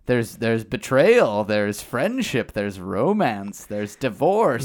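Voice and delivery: slow, hammed-up list with swooping emphasis; mock dramatic buildup. Hyping a wrestling love triangle, mock dramatic buildup. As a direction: draw out each scandal like a soap trailer. Hypes storyline like soap-opera trailer.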